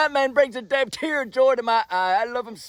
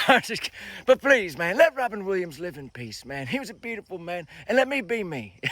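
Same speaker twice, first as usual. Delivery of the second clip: ordinary talk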